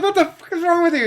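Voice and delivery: high, loud imitated outrage; mock outraged yell. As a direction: yell it like you can't believe him. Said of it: "it's like".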